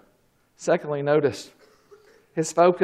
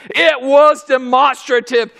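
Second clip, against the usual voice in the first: normal talking voice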